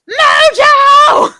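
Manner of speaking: high, loud drawn-out holler; yelling. Retold or imitated. Calling Mojo back in for his song, yelling. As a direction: yell his name across the club. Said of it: "I'm yelling, like, three times".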